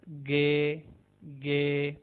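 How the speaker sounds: "गे" with a hard G as in God; Turkish G. Shown as a demonstration. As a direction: say "गे", not "जी". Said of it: "जैसे God के अंदर जी". ("गे" God वाली आवाज़ में)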